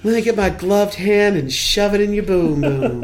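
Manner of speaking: hammed-up sinister predator; creepy voice. Creepy character joke voice.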